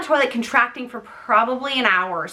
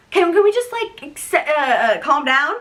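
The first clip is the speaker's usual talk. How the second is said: mock nervous plea; 5 semitones higher than usual. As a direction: act out flustered stalling. Retold plea to eager midwife.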